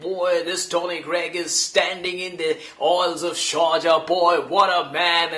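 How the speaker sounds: gushing, hyped commentator voice; Tony Greig impression. Imitating Tony Greig praising himself in front of him. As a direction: sell him like the greatest man alive. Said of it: "a whole monologue on how Tony Greig would describe Tony Greig".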